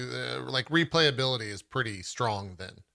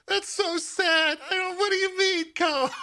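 very high-pitched, drawn-out mock wail through laughter; laughing dismay; slower than usual. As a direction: wail it in comic disbelief, cracking up. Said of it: "Sorry, I'm laughing 'cause I'm just like".

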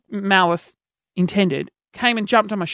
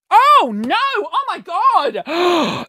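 mock horror; 9 semitones higher, much slower than usual. Remembering the head injury, in mock horror. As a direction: act out shock at remembering.

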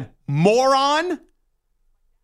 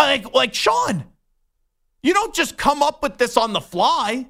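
normal talking voice

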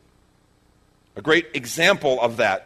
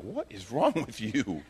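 disbelieving disciples; 3 semitones lower, 6 dB quieter, slower than usual. Acted. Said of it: "disciples at that moment just looking at him like".

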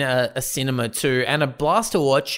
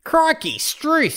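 very high, hammed-up Aussie exclamations; mock Aussie outburst, pitched way up; Tarantino's Australian accent; 13 semitones higher, slower than usual. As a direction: spoof an over-the-top Australian accent. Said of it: "the greatest Australian accent".

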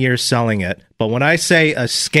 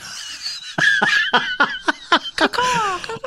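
shrieking high cackle of a laugh; shrill screeching bird woman; 5 dB quieter than usual. Real, not acted. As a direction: let out a piercing screechy laugh. Host cracks up at listener text.

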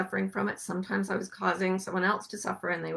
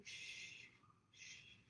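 barely audible gentle shushing; soft shushing; 29 dB quieter, slower than usual. Shown as a demonstration. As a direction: hush softly to calm. Soothing demonstration.

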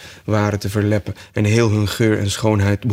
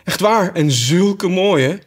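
higher, louder, slowing in delight; 9 semitones higher, 4 dB louder, slower than usual. Acted. Alice delights at the bulrushes, high and gushing. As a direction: gush over the bulrushes. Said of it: "in een plotse vervoering van vreugde".

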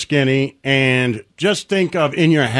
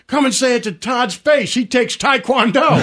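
high-pitched challenge yelled across the field; retold yell; 11 semitones higher than usual. Kid yells at bully across the outfield, high pitched. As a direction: shout it up high like a cocky kid. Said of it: "And I yelled".

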